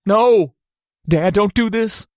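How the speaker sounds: high, loud desperate cry; desperate shout. A narrator's reading. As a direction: scream it in horror. Father flatlining.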